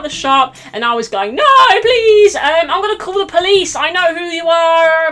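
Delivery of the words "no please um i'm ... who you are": high, loud mock pleading; hammed-up high-pitched protest; desperate calling out. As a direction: call helplessly after a fleeing thief. Acting out pleading after fleeing thief.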